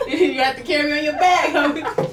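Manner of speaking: loud, high laughing banter. Real, not acted. Joking with her friend about side cramps, laughing loudly. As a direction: laugh through the joke, loud.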